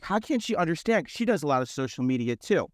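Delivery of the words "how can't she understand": retold exasperation, higher pitch; mildly exasperated, pitched up; reliving a frustrated complaint without raising volume. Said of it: "I was, like, so frustrated".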